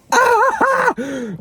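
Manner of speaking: high loud burst of laughter; shrieking laugh. Real, not acted. Laughing about her tattoo, high shrieking laugh. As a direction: laugh out in delight.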